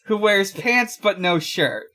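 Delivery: ordinary talk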